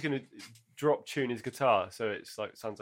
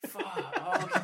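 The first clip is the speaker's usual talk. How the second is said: ordinary delivery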